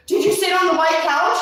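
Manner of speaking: high, loud mock outrage; imitating a horrified rich homeowner; scandalized scolding. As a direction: shriek it like an appalled host. Imitating rich people scolding about couch.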